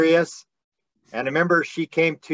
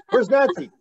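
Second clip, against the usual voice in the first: calling out; 7 semitones higher than usual. Retold or imitated. Calling out looking for Nancy.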